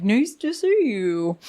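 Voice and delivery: drawn-out, slow greeting; slow, stretched imitation of a politician's hello; imitated politician greeting. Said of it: "being like".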